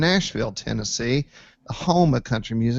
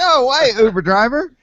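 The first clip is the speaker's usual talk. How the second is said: much higher and louder, stunned; amazed disbelief; 9 semitones higher, 5 dB louder than usual. Retold or imitated. Amazed that the Uber driver knows him, loud and high. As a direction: gasp it out, pitched way up.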